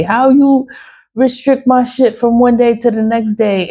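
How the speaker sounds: high-pitched, incredulous complaint; exasperated protest. Real, not acted. Vlogger venting at whoever restricted her account, exasperated and high. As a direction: demand an explanation, pitch held high.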